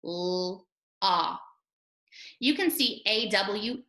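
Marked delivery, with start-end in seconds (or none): 0.1-1.4 L, ah held long and separately; sounds stretched out. Shown as a demonstration. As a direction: stretch the L, pause, then a long ah. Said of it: "Let's stretch it out".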